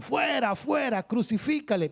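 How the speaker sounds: a mild mock yell of the mob; crowd shouting. A narrator's reading. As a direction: cry it out like the angry crowd. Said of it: "Pero ellos gritaron".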